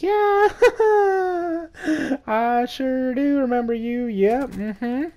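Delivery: high, slow, panicked fake cheer; nervous squeak. Teen caught at the door by the cop, high nervous squeak.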